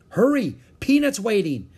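high, loud, eager call; eager urging. A narrator's reading. Annie rushing back to Peanut, eager urging. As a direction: hurry them along, pitched high and loud.

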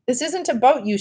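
high-pitched, irritated outburst; mild yell. A narrator's reading. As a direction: read a sister's yell without real volume. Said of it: "she yelled".

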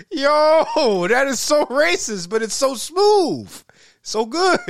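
high, loud amazed laughing reaction; reliving the shock with delight. Retold or imitated. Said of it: "I was just like".

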